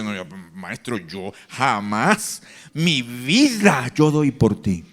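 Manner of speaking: low, slow, solemn oath; solemn Peter vow. Retold or imitated. Peter at the last supper vowing to Jesus, low solemn oath. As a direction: swear it deep and grave.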